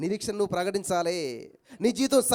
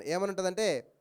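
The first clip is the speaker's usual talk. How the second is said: normal talking voice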